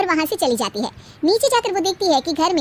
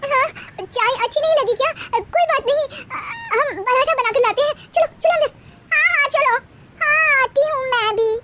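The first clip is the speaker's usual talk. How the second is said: put-on much higher, indignant girlish voice; youngest daughter-in-law; 10 semitones higher than usual. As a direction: insist on your rights, pitched way up. Bahus, high voices.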